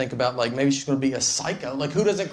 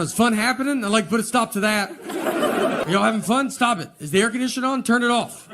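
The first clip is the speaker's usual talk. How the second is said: higher, stern dad policing the fun; fun-hating dad; 7 semitones higher than usual. Retold or imitated. Killjoy spouse stopping the family's fun, mock stern dad. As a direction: play the killjoy dad shutting everything down. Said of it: "someone that just walks around".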